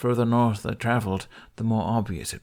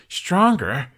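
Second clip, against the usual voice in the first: higher, louder outraged echo; indignant; 8 semitones higher, 7 dB louder than usual. Acted. Hiccup outraged at Toothless's excuse, loud indignant echo. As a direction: throw the word back in disbelief.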